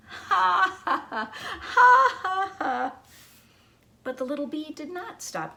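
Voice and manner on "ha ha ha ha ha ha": high, loud laughing ha-has; big hammed-up laugh; mocking laughter. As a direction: laugh down at the little bee. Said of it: "long enough to laugh".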